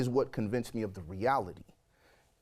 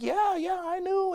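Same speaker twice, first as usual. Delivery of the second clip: put-on lightly raised casual girlfriend voice; high voiced girlfriend; 3 dB louder, slower than usual. Girlfriend on phone admitting she knew, high casual.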